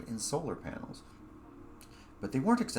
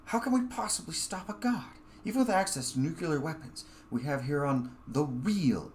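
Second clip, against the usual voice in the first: alarmed doubt; 7 semitones higher than usual. Acted. Narrator doubts defeating a god.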